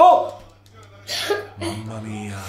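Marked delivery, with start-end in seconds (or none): 0.0-0.4 surprised shout. Real, not acted. Reacting to near goal.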